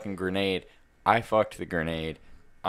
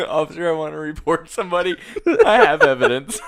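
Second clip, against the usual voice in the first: laughing; 7 semitones higher, 8 dB louder than usual. Real, not acted. Joking report to police, laughing.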